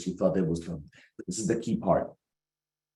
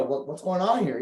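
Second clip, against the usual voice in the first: mock offended shock; 10 semitones higher than usual. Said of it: "like, you know".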